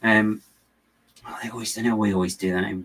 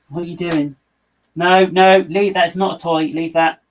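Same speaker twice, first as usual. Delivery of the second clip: much higher and louder, telling the cat off; scolding the cat; 8 semitones higher, 10 dB louder than usual. Real, not acted. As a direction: shout the cat away from the toy. Cat grabbing something on the desk.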